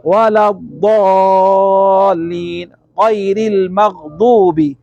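very slow, drawn-out recitation; Quran recitation. Shown as a demonstration. Teacher recites the Fatiha line as an example. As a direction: stretch each word like tajweed recitation. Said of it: "এক side হয়ে উচ্চারণ করতে হবে".

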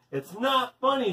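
understated shout. A narrator's reading. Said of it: "shouted as loud as she could".

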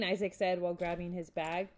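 usual speaking voice

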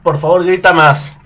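loud, high frantic yelling; panicked shouting. Real, not acted. Gamer panicking as enemies close in, frantic loud yelling.

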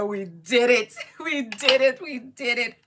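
loud, high, laughing cheer; excited cheer. Retold or imitated. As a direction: celebrate the win out loud. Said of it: "I said".

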